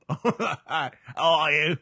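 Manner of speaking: high, laughing mock British; British accent. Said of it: "speaks in a British accent".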